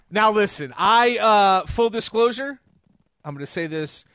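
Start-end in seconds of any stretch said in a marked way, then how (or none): 3.2-4.1 softer, hushed aside; quietly. Real, not acted. To co-host, hushing a confession quietly. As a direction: drop the voice to confess it. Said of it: "very quietly".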